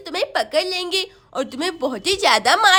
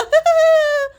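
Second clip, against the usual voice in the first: high, loud witch cackle; evil cackle; 7 semitones higher, 5 dB louder than usual. Acted. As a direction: cackle wickedly. Witch laughs.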